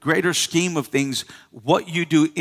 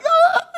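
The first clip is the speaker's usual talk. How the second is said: very high surprised squeal; a sudden high-pitched yelp of shock; mock squeal; 4 dB louder than usual. Preacher playing a newly pregnant woman, high shocked squeal. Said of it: "You're like".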